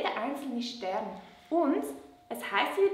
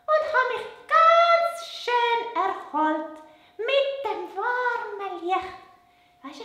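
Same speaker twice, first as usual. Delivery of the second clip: much higher little puppet voice; 8 semitones higher than usual. Acted. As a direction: puppet girl, pitched way up. Puppet Lola explains.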